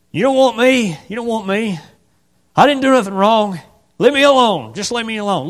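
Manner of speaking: weak lame voice. Acted. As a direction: plead to be left alone. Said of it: "this grovelly, weak, lame voice".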